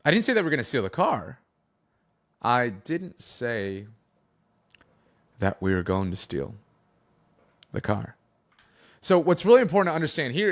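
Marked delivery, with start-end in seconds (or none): 0.0-1.4 quick, higher-pitched run-through without a break; fast tempo. Shown as a demonstration. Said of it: "fast or slow?". 2.4-8.1 very slow, with long pauses between phrases; slow tempo. Shown as a demonstration. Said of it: "fast or slow?".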